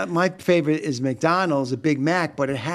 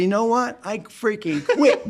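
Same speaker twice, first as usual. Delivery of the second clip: mock defiant quitting; 6 semitones higher, slower than usual. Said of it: "I said".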